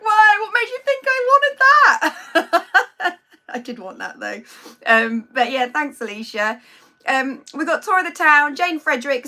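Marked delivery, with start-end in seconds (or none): 0.0-3.2 high, loud mock outrage; mock indignation. Thanking a viewer for wine, in hammed-up mock outrage. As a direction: act scandalised by the gift, hammed up. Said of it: "I was like".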